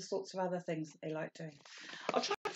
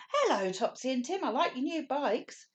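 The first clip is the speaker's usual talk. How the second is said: put-on child shouting hello; 8 semitones higher than usual. Josie calls hello to Topsy and Tim, higher cheerful shout. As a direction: call out brightly to the twins. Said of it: "one of them shouted".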